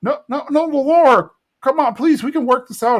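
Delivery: high-pitched begging; hammed-up imploring lover; pleading Bill. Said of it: "And Bill's like".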